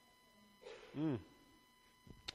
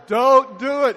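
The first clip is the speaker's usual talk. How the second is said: loud, higher, emphatic warning; God's firm command; 5 semitones higher, 11 dB louder, slower than usual. Acted. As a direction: forbid it with full force. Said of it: "God says".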